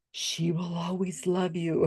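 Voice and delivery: slow, breathy spirit whisper; hushed medium's message from beyond; ghostly whisper. Retold or imitated. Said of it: "I said".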